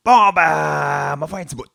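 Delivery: grand, booming declaration; loud proclamation. Retold or imitated. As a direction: proclaim it like a prophet. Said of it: "Jésus déclara".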